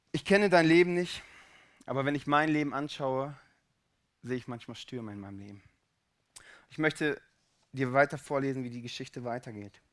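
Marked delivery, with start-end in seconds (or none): none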